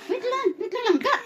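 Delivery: high-pitched, alarmed hold-on; urgent wait. Real, not acted. Urgently calling to squirming child.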